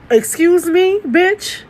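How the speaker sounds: high, sharp "excuse me" in disbelief; indignant retort. Retold or imitated. To the date denying her Hennessy, indignant outrage. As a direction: throw it back at him, offended.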